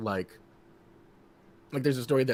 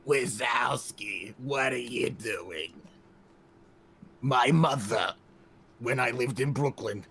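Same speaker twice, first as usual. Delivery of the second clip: Roz from Monsters, Inc. Retold or imitated. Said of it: "or you can go like Roz from Monsters, Inc".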